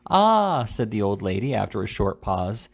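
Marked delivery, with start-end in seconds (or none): 0.1-0.6 put-on high, loud old woman's "Ah"; a deaf grandmother's exclamation; old lady's cry. A narrator's reading.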